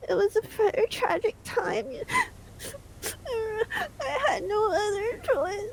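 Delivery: hammed-up high sobbing lament; mock tragic wail. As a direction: overplay the tragedy, slow and teary. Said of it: "We're done with that bit".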